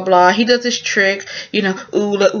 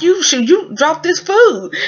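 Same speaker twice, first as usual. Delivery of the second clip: higher, slow indignant retort; mock accusing; 8 semitones higher than usual. Girl blaming the player for the spilled food, indignant. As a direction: throw the blame back. Quarrel over pearl.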